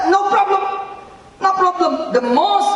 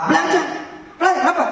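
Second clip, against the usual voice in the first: rhetorical call; 11 semitones higher, much faster than usual. Acted.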